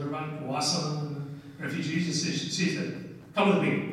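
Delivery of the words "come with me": retold order, falling at the end; firm command. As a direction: imitate someone who won't take no. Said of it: "There was no "will you come?".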